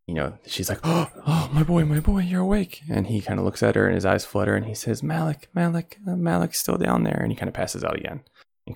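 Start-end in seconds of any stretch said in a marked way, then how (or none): 0.8-2.8 high, tearful mother's cry of relief; relieved mother. Acted. Mother to her waking son, high and overjoyed. 5.0-7.2 lightly voiced faint boy, higher; a dazed boy mumbling a warning; weak boy. Boy to his mother, lightly voiced and faint.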